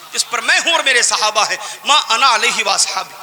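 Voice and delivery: ordinary talk, nothing marked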